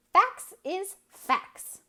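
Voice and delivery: slow, high, loud scornful verdict; contemptuous. A narrator's reading. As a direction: drag it out with scorn. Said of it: "voice expressed the utmost contempt".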